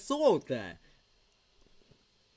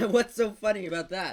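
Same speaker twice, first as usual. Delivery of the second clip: laughing; 2 semitones higher, 10 dB louder than usual. Real, not acted.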